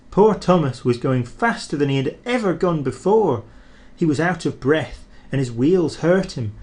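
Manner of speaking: usual speaking voice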